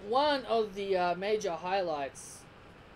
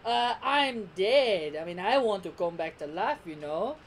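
hammed-up villain playing innocent; Frieza; 2 semitones higher, 5 dB louder than usual. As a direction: stretch the words in feigned surprise. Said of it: "it's like Frieza's just saying".

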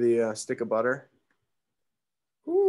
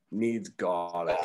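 put-on slow, drawled Australian accent; 4 semitones lower, much slower than usual. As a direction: say it like an Aussie bushman. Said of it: "with this Australian accent".

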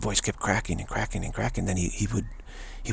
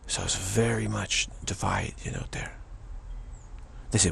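normal talking voice

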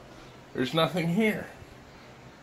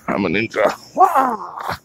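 playful ninja; 5 semitones higher, slower than usual. Pretend. Playing ninja.

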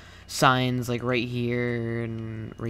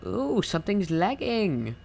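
surprised; 8 semitones higher, slower than usual. Real, not acted. Surprised at lag.

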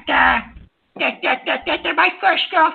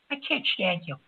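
normal talking voice

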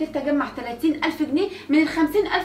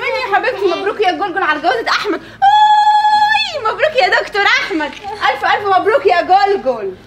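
sarcastic congratulations; 6 dB louder than usual. Acted. Wife mocking sister-in-law over Ahmed's marriage, loud sarcastic cheer.

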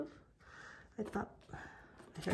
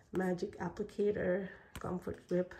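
ordinary talk

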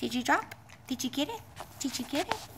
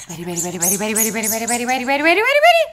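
building excitement; 4 semitones lower, 6 dB louder, much faster than usual. Real, not acted. Owner winding up her dog before the throw, building to loud excited. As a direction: wind the dog up until it bursts.

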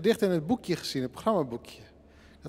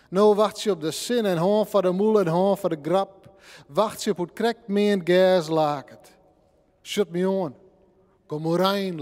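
lifted, incantatory poem reading; Frisian poem recital; 3 semitones higher, 4 dB louder than usual. Acted. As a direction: chant the repeated sounds, drawing them out. (bezwerende Friese gedichtvoordracht)

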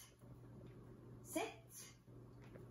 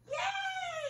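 high, loud cheering yay; excited shout; 8 dB louder than usual. Real, not acted. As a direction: shout with joy for the dog. Dog completes circle.

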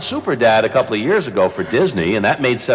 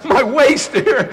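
much higher, laughing mock dismay; laughing while quoting; 12 semitones higher than usual. Retelling his on-air panic to the host, laughing high. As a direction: splutter it through laughter. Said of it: "and I'm saying".

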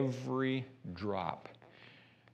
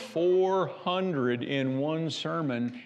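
slow emphatic; 5 semitones higher, 4 dB louder, much slower than usual. Real, not acted. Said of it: "emphatic slow preaching".

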